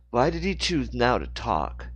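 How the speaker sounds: usual speaking voice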